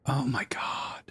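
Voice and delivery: exasperated whisper. Real, not acted. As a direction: breathe it out, unvoiced dismay. Realises missing spell.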